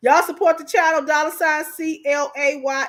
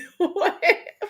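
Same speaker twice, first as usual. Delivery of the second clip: high laugh after your own joke; giggle; 5 semitones higher than usual. Real, not acted. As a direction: crack up, embarrassed by the confession. Laughs at her own imitation.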